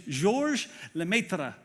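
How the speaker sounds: slow attempt at a French accent. Shown as a demonstration. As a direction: give the name your best French accent. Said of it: "That's my best French accent".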